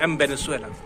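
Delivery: एम said as em, v turning to b in वेनेजुएला; m before v. Shown as a demonstration. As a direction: close the lips on एम. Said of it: "हल्का सा मैं".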